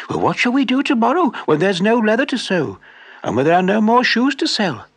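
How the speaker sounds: lightly voiced fretting wife; worried wife. Wife asks about tomorrow.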